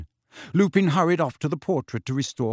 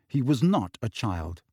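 usual speaking voice

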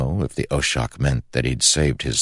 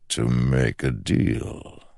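slow ancient shadow voice; slower than usual. Acted. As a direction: speak like a patient shadow god. The shadow speaks.

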